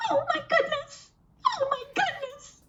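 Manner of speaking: tiny, high, soft squeaky hedgehog; little hedgehog voice. Acted. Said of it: "a little voice saying".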